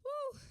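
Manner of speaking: high, loud excited woo; cheer. Real, not acted. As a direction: whoop for the performers. Cheering again.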